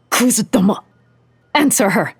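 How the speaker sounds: sharp angry shout. A narrator's reading. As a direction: yell the order at him. Miyako forcing him to talk.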